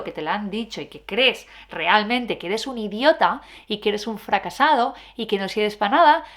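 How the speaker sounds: ordinary delivery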